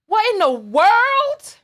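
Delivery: what in the world, incredulous; disbelief. Real, not acted. Reacting to a rude comment.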